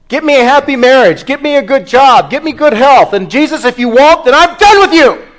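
high, loud, overdramatic ultimatum; overdramatic demand. Acted. Churchgoer treating Christ as errand boy, overdramatic. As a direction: build to a loud, high outburst at Jesus. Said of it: "just a tad over dramatic".

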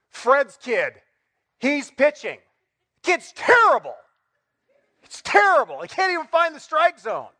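dad home from Little League griping to his wife; ticked-off dad ranting. Retold or imitated. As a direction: rant like a sulking sports dad. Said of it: "you're all ticked off".

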